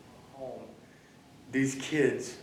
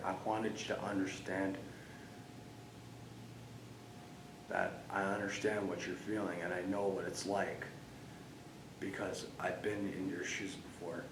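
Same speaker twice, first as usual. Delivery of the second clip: much softer, low and heartfelt; quiet confession; 6 semitones lower, 12 dB quieter than usual. Real, not acted. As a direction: speak gently, almost breaking. Confessing past pain to Oscar.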